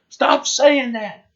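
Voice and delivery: slow, high exasperated cry; exasperated shout. Retold or imitated. Retelling exasperated opponent in duel.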